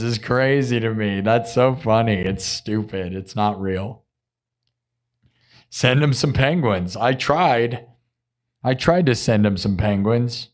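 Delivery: ordinary delivery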